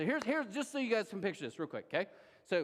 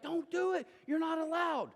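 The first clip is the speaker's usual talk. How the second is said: higher, urgent shout to stop him; alarmed warning shout; 9 semitones higher than usual. Pretend. Lepers shouting to stop their friend, alarmed shout. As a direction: cry out in alarm.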